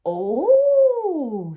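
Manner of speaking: high, very loud drawn-out oh; astonished bees. Acted. Little bees shocked at how big Norman is, loud oh. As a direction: let it swell in shocked amazement. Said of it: "said the little bees".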